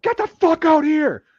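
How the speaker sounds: very high, loud, drawn-out shout; furious yelling. Retold or imitated. Drill sergeant grabbing dazed recruit, furious yelling. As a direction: yell it like an enraged drill sergeant. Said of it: "the yelling starts".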